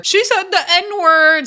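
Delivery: high, slow mock drama tone; mock the storyline, high and drawn out. Vlogger mocking the recurring storyline, high and slow.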